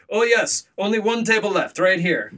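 high-pitched imitation of the seller; Russian seller. Storyteller mimicking the seller offering her last table, high-pitched. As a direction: pitch it up as a caricatured saleswoman. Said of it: "And she's like".

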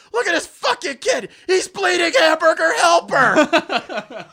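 high, loud mock yell; yelled quote. Richie yelling about the bleeding kid. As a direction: shout it at the injured kid. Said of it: "he goes".

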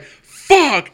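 loud high curse on seeing the cop; exclaiming. Retold or imitated. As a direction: blurt it out in dismay. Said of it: "and I'm like".

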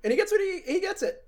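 gleeful high voice. Real, not acted.

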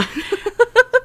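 high giggle; high burst of laughter. Real, not acted. Laughing at own joke.

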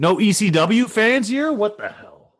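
high-pitched comic dismay; incredulous, voice climbing high; mock disbelief. Real, not acted. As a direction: appeal to a room that missed your reference. Mock dismay that reference fell flat.

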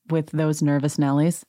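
usual speaking voice

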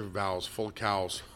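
soft chuckle through the corrected words; laughing at a slip. Real, not acted. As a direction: laugh quietly at your own mistake. Laughs at own misreading.

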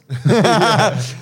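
big bursting laugh, high and loud. Real, not acted. Laughing loudly at Joe's doubtful look. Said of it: "Joe's looking at me like".

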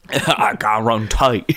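slow, laughing Cajun guarantee; mock Cajun. Mimicking Cajun catchphrase.

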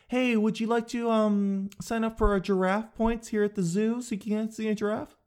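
eager upsell at the zoo; chirpy clerk pitch. Retold or imitated. Zoo clerk offering giraffe points, chirpy and high. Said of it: "Listen to how I say no".

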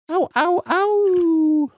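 high howling yelps; wolf howl. Acted.

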